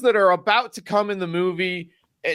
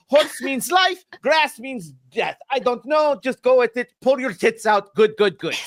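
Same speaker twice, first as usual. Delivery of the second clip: fake Italian accent; 5 semitones higher than usual. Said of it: "give him generic fucking accents".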